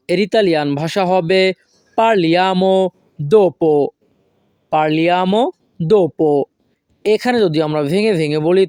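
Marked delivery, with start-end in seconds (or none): 2.0-6.4 very slow teaching pronunciation, word by word; slow model phrase. Shown as a demonstration. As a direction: model it slowly for language learners. Teacher models new phrase for learners.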